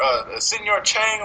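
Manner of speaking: normal talking voice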